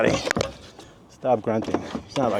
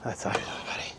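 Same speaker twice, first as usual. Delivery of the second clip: soft unvoiced whisper; 12 dB quieter, faster than usual. Real, not acted. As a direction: whisper it to yourself. Praise repeated as a whisper.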